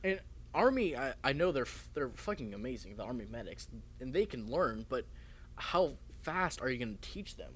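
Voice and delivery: usual speaking voice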